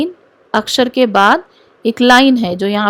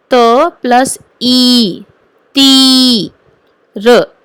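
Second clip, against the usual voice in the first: slow spelling out; 4 dB louder, much slower than usual. Shown as a demonstration. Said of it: "सुनो ध्यान से".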